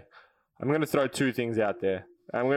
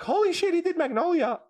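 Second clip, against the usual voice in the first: amazed; 14 semitones higher than usual. Retold or imitated. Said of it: "Dan was like".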